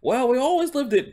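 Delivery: high, loud Cockney horse voice; Cockney coach horse. Acted. Horse telling where his family lived, Cockney accent. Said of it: "he's speaking in a Cockney accent".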